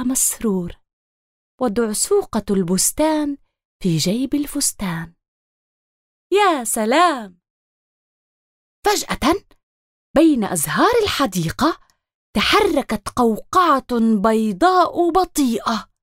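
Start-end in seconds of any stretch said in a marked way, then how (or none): none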